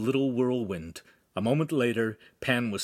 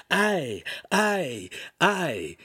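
high, exaggerated mimicked stammer; hammed-up falling cries; mocking; 7 semitones higher, much slower than usual. A narrator's reading. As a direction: mimic his stammer with scorn. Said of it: "doubled over in mock laughter".

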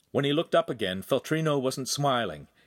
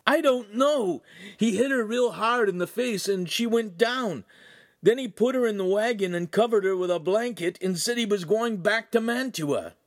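high, distraught recounting; frantic answer; 12 semitones higher, 4 dB louder than usual. A narrator's reading. Ugolino describing the attack to friends, high distraught voice. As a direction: tell it in panic, voice pushed way up.

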